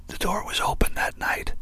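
hushed, voiceless whisper. A narrator's reading. Jill to Zack at the dressing room door, whispered. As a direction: whisper it under your breath. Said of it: "Jill whispered".

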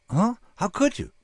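high-pitched baffled question; a puzzled, high-voiced "huh?". A narrator's reading. Frank baffled by Bill's remark about the problem, baffled.

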